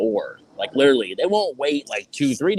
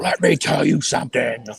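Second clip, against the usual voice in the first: wrestling promo; 4 dB louder than usual. Pretend. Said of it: "Just cut a promo".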